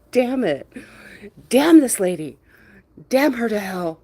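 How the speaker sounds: playful impression of someone fed up; mock pissed-off. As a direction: curse in hammed-up outrage, amused underneath. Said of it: "Try's all pissed".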